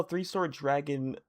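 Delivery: normal talking voice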